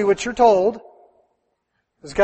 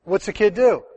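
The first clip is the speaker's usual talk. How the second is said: ordinary talk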